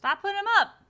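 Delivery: loud, high frustrated shout at the TV; exasperated outburst at the houseguests; real yell at the screen. Real, not acted. Yelling at the TV edit.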